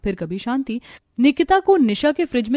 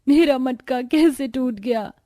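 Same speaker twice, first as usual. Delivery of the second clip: slow, raised tearful cry; tearful wail; 5 semitones higher, slower than usual. A narrator's reading. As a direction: wail it out, drawing the words long. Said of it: "टूटा मटका देख रोने लगती है".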